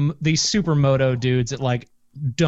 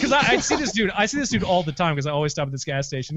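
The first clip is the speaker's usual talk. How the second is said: normal talking voice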